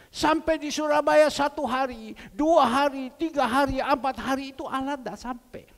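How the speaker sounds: loud storytelling sermon voice; preaching. Acted. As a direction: build the tale for the church.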